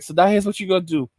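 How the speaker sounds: low, quick instructing pimp. Acted. The streets instructing the girl, low smooth pimp voice. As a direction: lay out orders fast and low. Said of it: "the streets is a pimp".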